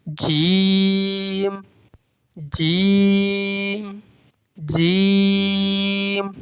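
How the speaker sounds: "جیم" with a very long level ee; drawn-out monotone recitation of "جیم"; five-count elongation. Shown as a demonstration. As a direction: hold each "جیم" out slowly before closing the m. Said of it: "اب جیم کیسے پڑھیں گے".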